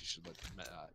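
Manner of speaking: low, soft ogre tavern keeper; low and hushed, like a gentle giant introducing himself; Grunt, the nighttime tavern keep, low and soft; low ogre barkeep. Acted.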